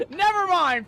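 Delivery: high, loud cry through laughter; laughing exasperation. Real, not acted. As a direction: give up loudly, half laughing. Gives up amid laughter.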